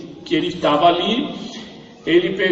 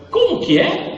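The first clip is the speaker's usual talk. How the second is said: surprised; 11 semitones higher than usual. Retold or imitated. Doctor surprised by request.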